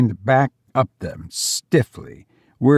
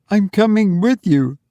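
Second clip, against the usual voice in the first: higher, firm woman voice; insist, higher pitched; insistent mother; 8 semitones higher than usual. Acted.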